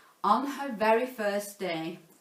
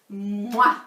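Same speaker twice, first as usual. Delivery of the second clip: 1 blown kiss; 8 dB louder than usual. Acted. Blow a kiss.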